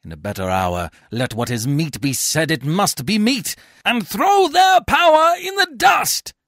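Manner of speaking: high, loud theatrical denunciation; furious tirade. A narrator's reading. Coriolanus rails against the tribunes.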